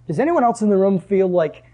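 ordinary delivery

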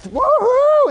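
high, loud whoop; imitating a crowd's excited woohoo; mock cheer. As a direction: whoop like a thrilled fan. Crowd cheering for rock star.

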